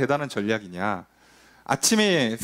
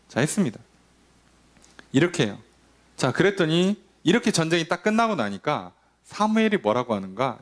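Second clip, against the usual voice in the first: usual speaking voice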